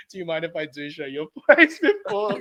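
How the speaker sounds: laughing through the words. Real, not acted. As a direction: crack up while saying it.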